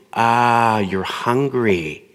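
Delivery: drawn-out, slow sympathetic acknowledgement; sympathetic parent. Acted. Parent validating a hungry child, slow sympathy. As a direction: soothe a little child, stretching the words.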